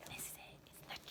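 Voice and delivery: hushed, creepy whisper; suspense whisper. A narrator's reading. Narrator teasing children before the big reveal. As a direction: whisper it to build dread.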